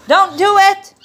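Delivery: high, loud warning to the dog; scolding. Real, not acted. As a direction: stop the dog sharply. Scolding dog not to bite.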